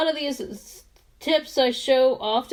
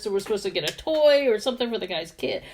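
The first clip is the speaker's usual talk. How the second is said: ordinary talk, nothing marked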